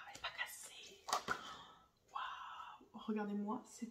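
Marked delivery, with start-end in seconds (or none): 0.1-1.0 relieved whisper. Real, not acted. Vlogger finding her palette intact, whispering in relief. Opening the feared-broken palette. 2.1-3.0 whispered wow. Real, not acted. Palette revealed.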